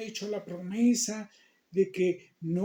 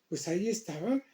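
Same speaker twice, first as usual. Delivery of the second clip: plain reading voice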